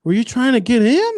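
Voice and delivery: high-pitched, exaggerated fake concern; sarcastic sympathy. Sarcastic guy questioning a crying lady, mock concern. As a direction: imitate an interviewer feigning shock. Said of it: "just so sarcastic".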